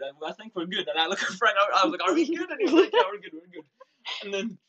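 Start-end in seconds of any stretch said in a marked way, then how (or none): 2.1-2.5 high-pitched, loud, anxious check-in; nervous high question. Retold or imitated. Asking Frank if they are safe, high nervous squeak. 2.9-3.6 high, loud, laughing yeah we're good; laughing reassurance. Retold or imitated. Frank reassuring his scared friend, high laughing reassurance.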